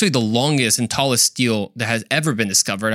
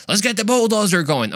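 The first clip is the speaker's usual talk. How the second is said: high, gung-ho dig order; mock eager treasure hunter; eager bulldozer crew; 8 semitones higher than usual. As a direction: cheer on the bulldozer, pitched up.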